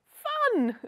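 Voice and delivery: high, loud burst of fake glee; exaggerated, put-on delight; mock excitement. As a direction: chirp it with sarcastic cheer. Sarcastic cheer at grim election news.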